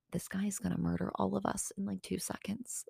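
very soft, under-the-breath worry; hushed alarm. Retold or imitated. Thinking beside the man threatening someone, hushed alarm. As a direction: say it very quietly, as if he might hear.

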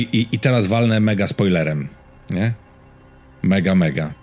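usual speaking voice

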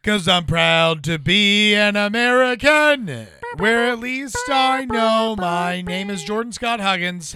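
loud, slow, proud announcer boom; patriotic announcer. Pretend. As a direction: belt it like a proud anthem. Mock patriotic intro announcement.

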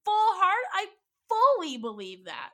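loud, high tearful pleading; sobbing. Retold or imitated. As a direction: plead through tears. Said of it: "I'm sobbing".

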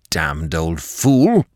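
slow, contained angry curse. A narrator's reading. Gerald curses the gardener in front of his wife, angry. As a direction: curse the old man slowly, with anger held in. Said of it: "said Gerald angrily".

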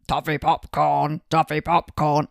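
lightly voiced disguise, not quite managed; half-hearted disguised voice. A presenter mimics a teacher's disguised voice, loud and slow. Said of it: "I can't do the voice for that one either".

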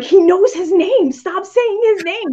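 mock alarmed inner voice; frantic inner voice. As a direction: scold yourself in your head, half-panicked. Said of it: "And in my brain, I'm going like".

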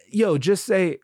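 usual speaking voice

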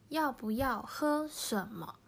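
要不要喝什么 said slowly, each tone clear; slow Chinese model. Shown as a demonstration. Teacher modelling a dialogue line, slow Chinese. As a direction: read 要不要喝什么 slowly for learners. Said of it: "今まで教えた会話の中に".

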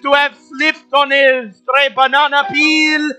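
loud, high play-by-play call; excited commentator calling the race; race announcer. Acted.

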